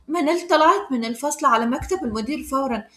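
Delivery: ordinary delivery, nothing marked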